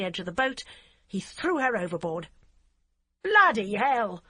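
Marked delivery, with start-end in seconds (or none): 3.2-4.2 slow, loud horrified cry. A narrator's reading. Barbara sees the girl thrown overboard, loud slow cry. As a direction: blurt it out in shock. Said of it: "Barbara cried".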